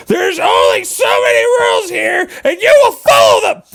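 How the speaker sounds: high shouting tyrant; angry enforcer voice. Acted. The spring ordering the particle around, high angry shouting. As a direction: bark orders like a furious rulekeeper.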